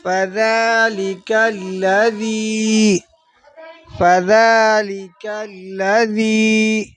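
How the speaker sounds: very slow melodic tajweed; measured Quranic chant; Quran recitation. Shown as a demonstration. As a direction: draw out each word like a reciter.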